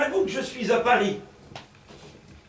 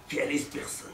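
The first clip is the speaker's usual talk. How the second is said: whispered aside; 6 semitones lower, 9 dB quieter, faster than usual. Acted. Aside to audience about stranger.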